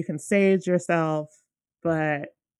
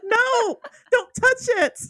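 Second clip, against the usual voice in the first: frantic plea; slower than usual. Retold or imitated.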